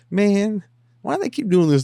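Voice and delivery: hammed-up frustrated woman voice; mock whining. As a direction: whine in a comic falsetto. Imitating her frustration.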